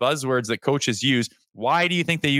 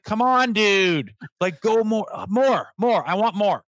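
high, insistent pleading; exasperated urging; 6 semitones higher, slower than usual. Retold or imitated. Fan urging hesitant players into battle, high exasperated plea. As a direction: egg them on like a frustrated fan.

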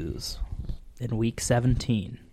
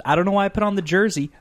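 high, quick, loud self-pity; mock hurt; 8 semitones higher, 6 dB louder, much faster than usual. Son regretting his team jersey, mock self-pitying. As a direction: rattle off a hammed-up fan's lament. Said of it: "I'm like".

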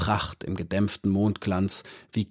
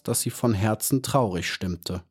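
ordinary delivery

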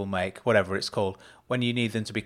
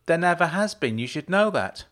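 high, loud urgent protest; higher-pitched, insistent outburst; blurted out; 8 semitones higher, 5 dB louder than usual. A narrator's reading. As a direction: blurt the denial out. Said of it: "Jenna blurted out suddenly".

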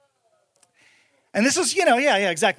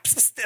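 spray hiss; 11 dB quieter than usual. Retold or imitated. Spraying roaches from the couch.

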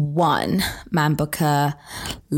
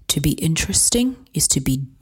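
dramatic reading. Acted.

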